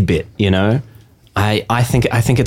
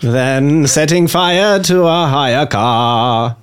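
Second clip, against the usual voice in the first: very slow, high, grand operetta voice; 6 semitones higher, much slower than usual. Shown as a demonstration. Hamming up the line in grand operetta style for friends. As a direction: stretch out every word like an operetta singer. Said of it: "isolate that audio ... as a ringtone".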